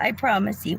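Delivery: ordinary delivery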